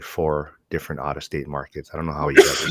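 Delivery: normal talking voice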